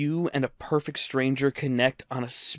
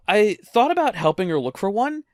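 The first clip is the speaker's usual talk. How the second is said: usual speaking voice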